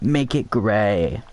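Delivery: ordinary delivery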